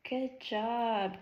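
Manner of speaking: normal talking voice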